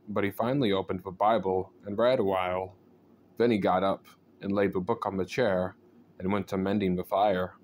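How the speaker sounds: ordinary delivery, nothing marked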